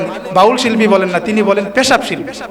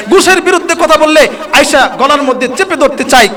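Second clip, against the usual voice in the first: higher, louder fiery preaching; preaching at full force; 4 semitones higher, 3 dB louder than usual. Acted. Preacher rebukes corrupt followers, fiery higher and louder. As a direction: drive it home to the crowd with fire.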